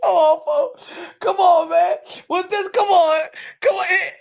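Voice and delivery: laughing hard, loud; helpless laughing come on. Real, not acted. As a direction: crack up while protesting. Laughing and crying out.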